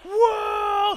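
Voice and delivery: very high, loud astonished cry; amazed shout. Acted. Thirsty crowd seeing water burst from the rock, amazed. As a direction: gasp it out in wonder. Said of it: "all of the people are like".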